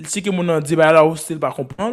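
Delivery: ordinary talk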